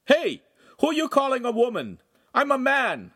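high, loud indignant shout; offended yell. Acted. Neighbor yelling at the oaf who called him a woman, indignant. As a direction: bark back in outrage. Insulted neighbor yells back.

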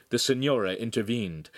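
plain reading voice

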